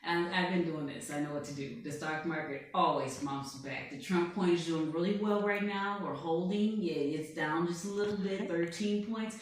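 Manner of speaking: boastful blustering tycoon voice, put on all through; Donald Trump. President boasting to an interviewer, put-on blustering voice. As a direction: bluff and brag like a showman president. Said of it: "I'm Donald Trump".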